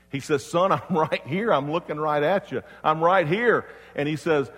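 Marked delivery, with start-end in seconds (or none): 0.4-3.6 raised, emphatic reassurance in a retold quote; acted dad calling back to his son, louder; louder and higher, insisting he is there; insistent father. Father answers the boy more insistently.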